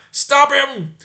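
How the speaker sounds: high, loud cry; urgent shout. Acted. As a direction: cry out to stop him.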